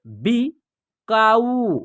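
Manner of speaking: बिकाऊ very drawn out, syllables apart; slow syllable by syllable. Shown as a demonstration. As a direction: read बिकाऊ slowly in parts. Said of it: "या आप direct भी पढ़ सकते हैं". (बिकाऊ धीरे-धीरे टुकड़ों में)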